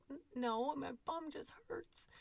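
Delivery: put-on high, whispered little boy voice; small child's whimper. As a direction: imitate a hurting kid, breathy and small. Enfant imité, voix chuchotée.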